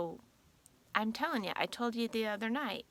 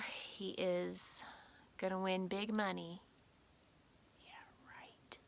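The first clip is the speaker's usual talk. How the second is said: whispered doubt about winning; sarcastic whisper; 4 semitones lower, 6 dB quieter, slower than usual. Real, not acted. Wife whispering sarcasm about husband's gambling trip. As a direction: murmur it like a secret joke.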